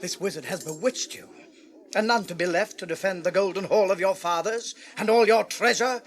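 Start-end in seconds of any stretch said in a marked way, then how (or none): 0.0-1.3 hushed, breathy near-whisper; sly whisper. Acted. Counselor whispering fears to the king, hushed. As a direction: lean in and murmur it.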